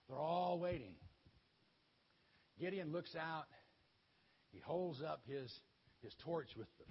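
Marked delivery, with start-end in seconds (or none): none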